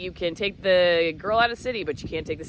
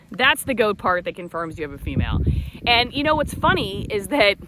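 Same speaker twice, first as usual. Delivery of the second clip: ordinary delivery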